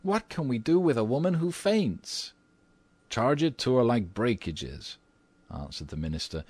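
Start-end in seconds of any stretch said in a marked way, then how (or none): 0.0-2.3 high-pitched lady character, exasperated; acted plaintive outcry from a wife; wifely cry. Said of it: "cried Missus Otis". 3.1-5.0 lightly voiced unruffled husband, pitched up; a hint of composed American gentleman; American minister. American husband answers his wife.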